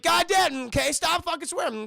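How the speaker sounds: high, loud fed-up counselor; mock exasperation. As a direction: imitate a counselor losing patience. Acted counselor losing patience.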